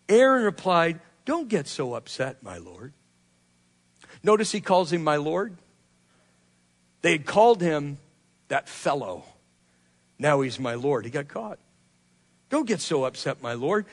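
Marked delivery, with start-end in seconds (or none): 1.2-2.9 low, hushed appeasing plea; mock-deferential, trailing down very soft; meek placating. Aaron caught out, placating angry Moses. 12.5-13.9 low, hushed appeasing plea; mock-deferential, trailing down very soft; meek placating. Repeats Aaron's placating plea to Moses.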